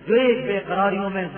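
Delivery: usual speaking voice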